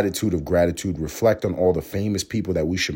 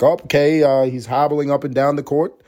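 mock announcer; 9 semitones higher, 6 dB louder than usual. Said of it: "They'd be like".

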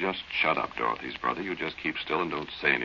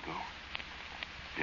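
trailing off into a whisper; a breath of a word; hushed whisper; 14 dB quieter than usual. Acted. Frightened at the well at night.

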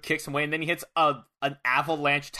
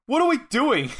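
high, loud mock-panicked yell; retold yell; 10 semitones higher, 5 dB louder than usual. Gene, terrified, yells at Suge, retold mock yell. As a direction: yell it at your partner, pitched up. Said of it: "he yells at Suge".

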